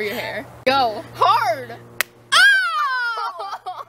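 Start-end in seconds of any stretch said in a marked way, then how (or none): 2.3-3.8 high, drawn-out "oh" shriek; startled shriek. Real, not acted. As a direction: shriek out in real shock. Reacting to the cracked egg.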